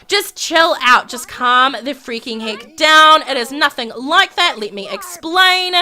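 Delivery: slow, emphatic exasperated plea; acted-out exasperation in a lovers' quarrel. Acted lovers' quarrel, she protests.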